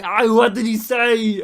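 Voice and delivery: loud, hammed-up Austrian action hero; Schwarzenegger. Action star questions his translator, bellowing. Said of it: "And Schwarzenegger's like".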